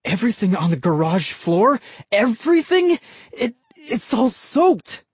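rising disbelief at the damage; a neighbor dismayed at his soaked garage; aghast disbelief. Acted. Neighbor sees ruined garage.

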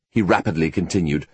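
ordinary delivery, nothing marked

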